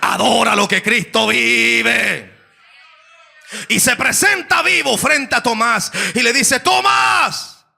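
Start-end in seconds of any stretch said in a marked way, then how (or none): 3.7-7.6 full-force preaching. Acted.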